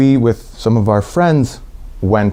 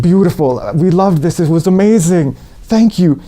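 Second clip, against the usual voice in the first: enthusiastic praise; 8 semitones higher than usual. Retold or imitated. The tent thanking the American guitarist, gushing and high. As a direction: gush like a grateful crowd. Crowd praising his playing.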